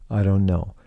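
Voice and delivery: ordinary talk, nothing marked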